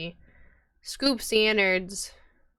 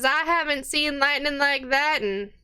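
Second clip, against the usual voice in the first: higher, lightly voiced barmaid; a worried tavern keeper's voice, pitched up; 6 semitones higher than usual. Barmaid warns patrons about the priest, lightly higher.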